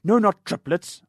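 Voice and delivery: slow, loud, cutting reply. Acted. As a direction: slice each word coldly. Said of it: "answered the doctor cuttingly".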